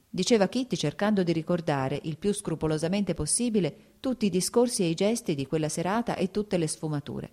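ordinary delivery

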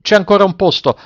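high narrated call-out; sailors' shout. A narrator's reading. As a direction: call out to the passengers, pitch well up. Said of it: "gridarono agli ore-, i marinai".

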